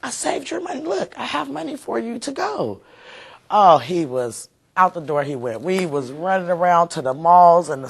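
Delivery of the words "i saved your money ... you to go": excited reassurance. Retold or imitated. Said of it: "But once I said".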